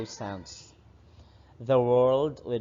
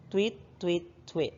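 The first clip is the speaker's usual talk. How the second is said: high chirpy tweets; bird tweet; 6 semitones higher, 3 dB quieter than usual. Shown as a demonstration. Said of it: "A bird says".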